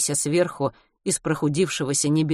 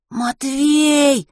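higher, loud, drawn-out scream of a name; piercing desperate cry; piercing wail; 9 semitones higher, 5 dB louder than usual. A narrator's reading. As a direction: shriek his name in alarm. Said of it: "пронзительный вопль".

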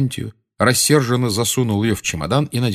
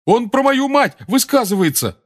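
high-pitched indignant yell; 14 semitones higher, 4 dB louder than usual. A narrator's reading. Seamus complaining to Ron about Harry, yelling. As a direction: cry out in outraged complaint. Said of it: "завопил Симус".

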